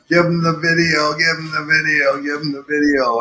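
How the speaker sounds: high-pitched chant of a yelling crowd, easing down; imitated crowd yelling. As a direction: chant it like a riled-up crowd, not at full volume. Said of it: "kept sort of yelling".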